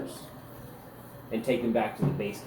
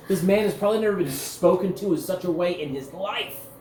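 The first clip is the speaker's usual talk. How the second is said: usual speaking voice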